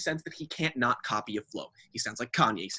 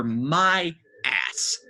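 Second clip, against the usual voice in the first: normal talking voice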